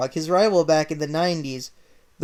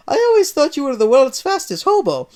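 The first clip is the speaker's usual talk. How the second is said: high, loud Italian jab; Mario mocks Sonic, loud teasing Italian; 9 semitones higher, 9 dB louder than usual.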